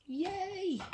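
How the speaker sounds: a little cheer, pitch jumping high; excited. Real, not acted. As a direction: squeal it with real delight. Happy to be on target.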